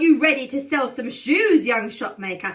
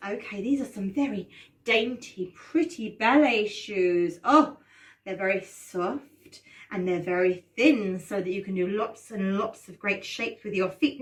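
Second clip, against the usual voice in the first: soft and gentle; 6 semitones lower, 5 dB quieter than usual. Acted. Describing dainty ballet shoes.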